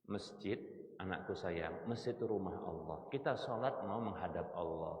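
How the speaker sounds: put-on soft, gentle advice to a little child; tender parent. Parent softly advising a child before the mosque, tender. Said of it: "Kasih arahan sebelum berangkat".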